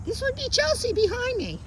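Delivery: high, sing-out introduction; high social voice. Real, not acted. Trainer introducing Chelsea to passers-by, high social voice. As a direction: introduce her in a lifted, cheery pitch.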